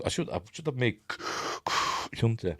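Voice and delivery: normal talking voice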